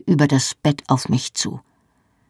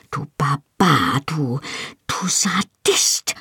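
slow, hissed insults, beside herself; seething hiss at her husband; furious hiss; slower than usual. A narrator's reading. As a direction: spit the words out in a whispered rage. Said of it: "zischte ich außer mir".

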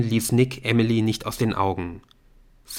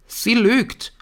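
high, loud outcry; indignant shouted denial; louder accusation; 14 semitones higher, 6 dB louder than usual. A narrator's reading. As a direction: raise your voice and accuse. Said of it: "diesmal lauter".

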